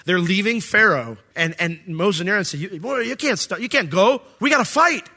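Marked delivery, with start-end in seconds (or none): 2.6-5.1 urgent pleading. Acted. Moses urges leaders to fight.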